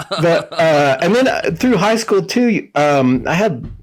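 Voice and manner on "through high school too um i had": ordinary talk, nothing marked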